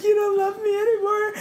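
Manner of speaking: very high, mock-sobbing plea; retold hysterical crying. Retelling a panic to the boyfriend, hysterical crying. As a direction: whine it high, imitating panicked tears. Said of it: "And I'm crying so hard".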